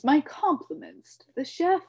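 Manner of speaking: high, loud, ending up on "chef"; pompous compliment rising. A narrator's reading. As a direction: lift "chef" like a grand toast. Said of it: "he announced to no one".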